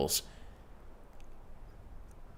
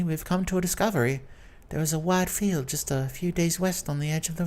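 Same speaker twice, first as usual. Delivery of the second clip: high lady voice; 7 semitones higher than usual. Acted. Duchess voiced in read story.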